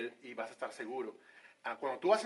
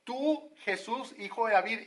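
solemn acclaim; 6 semitones higher than usual. Shown as a demonstration. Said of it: "no, dice".

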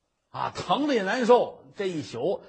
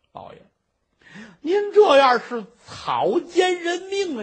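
much higher, louder tearful outcry; crying protest; 11 semitones higher, 8 dB louder than usual. Acted. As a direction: sob out the accusation. Merchant protests through tears.

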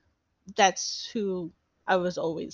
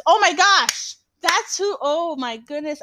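sudden realization; 7 semitones higher, 5 dB louder, slower than usual. Real, not acted. Realizes she forgot [name].

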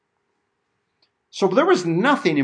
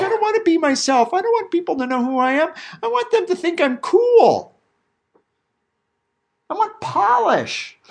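higher, mock kid pleading not to be himself, slowing on the last line; whiny teenager; 10 semitones higher than usual. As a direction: imitate an insecure schoolkid who wants to look cool. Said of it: "But they're all like".

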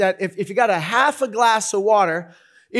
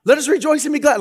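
high, loud, hammed-up cheer; rally a grouch with big gusto; mock-jubilant proclaiming; exuberant pep talk; 9 semitones higher, 8 dB louder than usual. Mock pep talk to grumpy cops.

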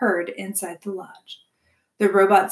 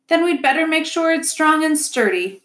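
much higher beaver builder voice; busy builder making plans; decisive beaver; 8 semitones higher, 4 dB louder than usual. A narrator's reading. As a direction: resolve it firmly, pitched up.

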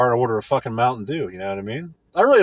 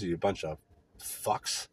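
usual speaking voice

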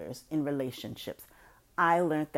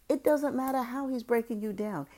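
normal talking voice

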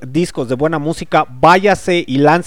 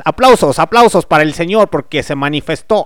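mock preacher; 6 semitones higher, 3 dB louder than usual. Mock preacher praising the Lord.

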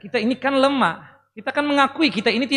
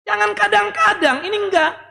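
higher pitched, pressing rebuke; stern emphasis; 10 semitones higher, 3 dB louder than usual. Real, not acted. Preacher scolding parents who only pray sometimes, a pressing higher rebuke. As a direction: push hard on kadang-kadang.

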